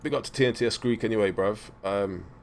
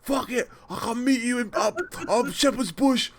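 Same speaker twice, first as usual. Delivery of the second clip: a retold half-shout, pitched up; mock shouted quote; 10 semitones higher than usual. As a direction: throw it out like an excited mate. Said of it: "he's like".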